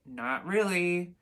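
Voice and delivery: slow, reluctant reply; short teen answer. Acted. Teenager not wanting to talk to mom, reluctant. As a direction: drag out a grudging answer. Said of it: "answer with short responses".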